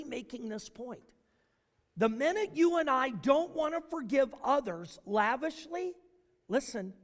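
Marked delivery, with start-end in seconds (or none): none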